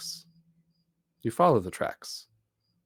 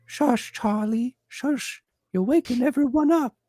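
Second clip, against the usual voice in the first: put-on child shushing; 14 semitones higher, 6 dB louder than usual. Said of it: "you can hear him just saying".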